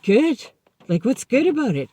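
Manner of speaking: mock outrage at being told good; incredulous. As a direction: throw his word back in disbelief. Incredulous reply to sponsor.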